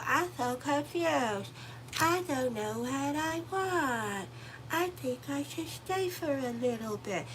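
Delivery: slow, high, dithering whine; imitating a flip-flopping client; mock confused client. Tarot reader mocking viewers who can't decide, in a slow whine. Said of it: "You know y'all go".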